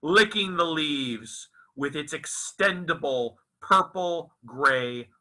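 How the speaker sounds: slow, lilting, poetic reading voice; story narration. A narrator's reading. Author reciting his novel to listeners, expressive storytelling narration. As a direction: read it like a dreamy prose poem, savouring each image.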